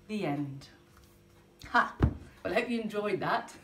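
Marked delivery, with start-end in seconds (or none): none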